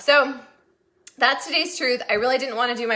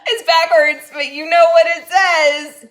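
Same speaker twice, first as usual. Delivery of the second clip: louder, slower, amused; 5 semitones higher, 9 dB louder, slower than usual. Real, not acted. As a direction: tease the viewer about the sign. Showing the sign, laughing.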